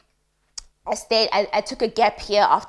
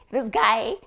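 usual speaking voice

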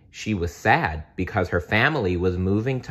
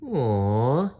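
gentle aw; 4 semitones higher than usual. Acted. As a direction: soothe the child. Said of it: "he said gently".

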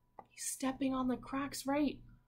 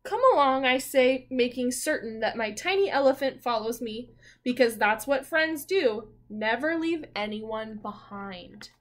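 louder storybook reading, slowing at the moral; story reading; 7 dB louder than usual. Acted. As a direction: read the lesson out warmly to the class. Reads the picture book aloud.

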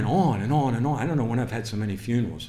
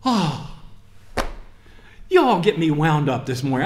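ordinary talk, nothing marked

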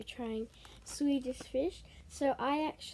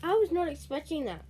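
pleasantly surprised; 5 semitones higher than usual. Real, not acted. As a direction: react to an unexpected treat. Surprised by taste, mouth full.

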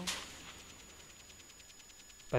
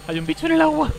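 high, loud hammed-up panic; mock panic; 13 semitones higher, 6 dB louder than usual. Streamer pretending to panic over a creature in the water, high mock shriek. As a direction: shriek it like you're half-joking terrified.

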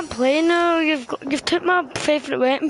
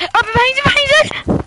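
much higher, loud urgent call; urgent warning shout; 11 semitones higher, 7 dB louder than usual. Real, not acted. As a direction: yell to warn your teammate. Warning teammate in game.

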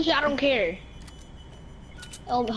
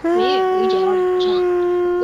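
louder, higher, slow hype; hyped gamer bro; 7 semitones higher, 8 dB louder, much slower than usual. Real, not acted. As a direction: shout the drop with hype. Hyped drop into game.

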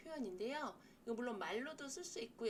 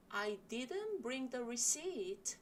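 slow model sentence; 2 semitones higher, much slower than usual. Shown as a demonstration. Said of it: "물론".

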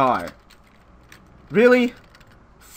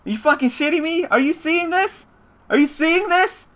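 loud, fast, furious complaining; furious rant; 6 semitones higher, 5 dB louder than usual. Real, not acted. Player raging at his opponent's lucky top deck, furious yelling. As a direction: blow up at the screen, shouting.

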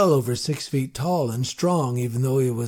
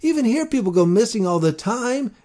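higher, louder urgent plea; pleading; 8 semitones higher, 5 dB louder than usual. A narrator's reading. Boy pleading with the marshal, higher and louder. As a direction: beg him for an answer. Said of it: "I pleaded".

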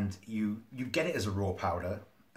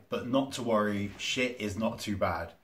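usual speaking voice

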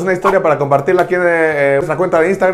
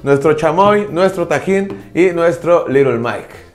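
show host voice; 4 semitones higher, slower than usual. Acted. Cooking show host hype.

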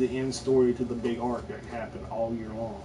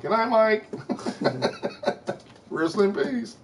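mock farewell; 9 semitones higher, slower than usual. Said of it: "say goodnight to me".

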